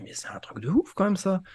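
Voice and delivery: retelling his own amazed reaction. Retold or imitated. Retold amazed self-quote.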